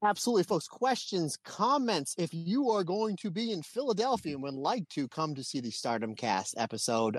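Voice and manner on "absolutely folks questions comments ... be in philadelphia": excited. Real, not acted. High-pitched excited sign-off.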